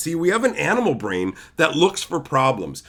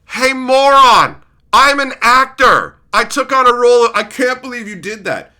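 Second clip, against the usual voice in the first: mock actor retort; 7 semitones higher, 9 dB louder than usual. Actor yelling back at an angry fan. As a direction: snap back as the fed-up actor. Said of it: "be like".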